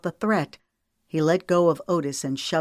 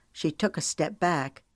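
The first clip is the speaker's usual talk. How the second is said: ordinary delivery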